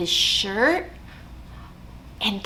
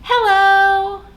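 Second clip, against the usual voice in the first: a sweet, sing-song hello, higher and louder; nicest hello; 9 semitones higher, 6 dB louder than usual. Shown as a demonstration. Librarian shows kids the nicest hello, sweet and singing. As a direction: say it sweetly like greeting a friend. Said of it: "as nice as we can".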